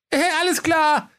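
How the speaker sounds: very high obliging shopkeeper; Höke's voice. Acted. Höke accepts the customer's request, high and obliging. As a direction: agree readily in a high comic voice. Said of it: "sagte der Höke".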